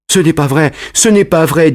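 lightly voiced frightened boy; trembling denial. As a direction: deny it like a caught child, a touch shaky. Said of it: "en rougissant et en tremblant".

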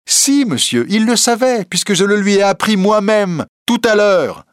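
high, insistent contradiction; emphatic young reporter. A narrator's reading. Reporter admits to the president he warned Larsan, emphatic. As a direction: contradict with full assurance.

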